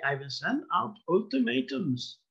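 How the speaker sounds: mock German accent with a falling end; fake German accent. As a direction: boast it in a hammed-up German accent. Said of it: "it has to be said with a fake German accent".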